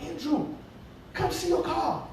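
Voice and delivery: high eager call to his son. Retold or imitated. Father calling his son out to the car again, high and eager.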